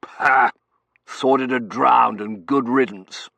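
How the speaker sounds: loud, slow, contemptuous outburst; hateful colonel. A narrator's reading. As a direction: spit it out with undisguised loathing. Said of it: "with undisguised hatred".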